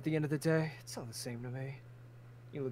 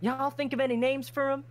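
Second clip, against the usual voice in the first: higher, louder Southern ask; eager Southern question; 10 semitones higher, 6 dB louder than usual. Acted. Farmer Crimson asking the party about names, eager Southern. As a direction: ask it keen, with a twang.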